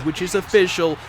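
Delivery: ordinary talk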